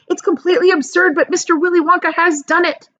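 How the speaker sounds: high, quick, loud delight; gleeful grandpa. Acted. Grandpa Joe marvelling to Charlie, fast and high. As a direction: revel in the absurdity.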